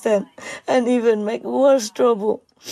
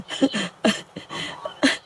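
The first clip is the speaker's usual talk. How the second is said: a soft breathy sob that falls away; a sob slipping out mid-sentence; choked sob; 5 dB quieter than usual. Real, not acted. Grieving owner, a quiet choked sob. Crying over suffering dog.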